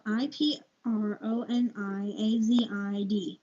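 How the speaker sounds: each letter of IPRONIAZID named slowly; spelling it out. Shown as a demonstration. Reader unsure of a drug name, slowly spelling it. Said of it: "Don't know if I'm pronouncing it right".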